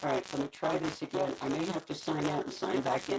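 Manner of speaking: metallic voice. Real, not acted. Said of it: "you can barely understand you".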